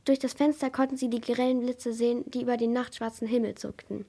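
ordinary delivery, nothing marked

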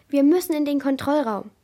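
loud, urgent shout over the storm; bellowed. A narrator's reading. Jasper yelling to the crew during the storm, loud shout. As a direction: roar it so the whole crew hears. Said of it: "brüllte Jasper".

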